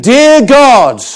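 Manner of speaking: pompous prayer opener, half-joking; mock grand preacher. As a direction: play the solemn preacher with a laugh underneath.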